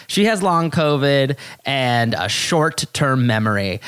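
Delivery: normal talking voice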